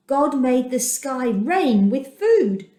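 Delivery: ordinary delivery, nothing marked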